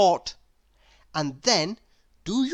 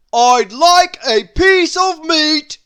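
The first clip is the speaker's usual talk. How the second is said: loud, high, slow bellow; booming shout; 10 semitones higher, 13 dB louder, slower than usual. A narrator's reading. Mister Noisy bellowing at the baker, loud and slow. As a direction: boom it across the shop. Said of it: "he boomed".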